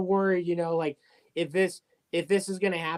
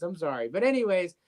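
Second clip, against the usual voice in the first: ordinary talk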